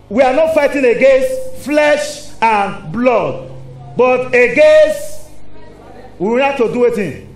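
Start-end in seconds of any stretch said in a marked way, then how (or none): 0.1-5.3 slow, louder preaching with stretched words; preached scripture. Acted. Preacher quoting Ephesians to the congregation, slow and loud. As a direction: declaim it slowly, rising and loud. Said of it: "he said".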